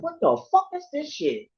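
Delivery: high startled outburst; shocked. Retold or imitated. Stunned by the numbers on the clock, high shocked outburst. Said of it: "I'm like".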